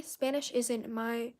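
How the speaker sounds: usual speaking voice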